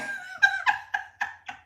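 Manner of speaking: high-pitched burst of laughter; high laugh at the trolls. Real, not acted.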